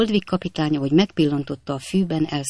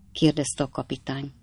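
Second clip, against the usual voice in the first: plain reading voice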